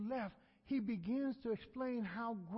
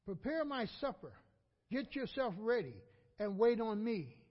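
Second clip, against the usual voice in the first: loud, higher demanding orders; commanding master; 3 semitones higher, 6 dB louder than usual. Acted. Master orders his servant after work, loud and demanding.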